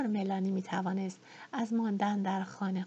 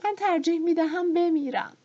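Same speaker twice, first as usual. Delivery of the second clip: higher, louder sobbing wail; 9 semitones higher, 8 dB louder than usual. A narrator's reading. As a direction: cry it out between sobs. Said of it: "حق حق کنان گفت".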